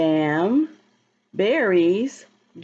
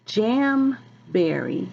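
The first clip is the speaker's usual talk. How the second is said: slow, drawn-out title; much slower than usual. Shown as a demonstration.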